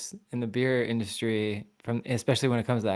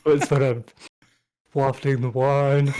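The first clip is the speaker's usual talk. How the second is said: put-on old man smart voice; 2 semitones higher, 4 dB louder, slower than usual. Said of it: "that was your old man smart voice".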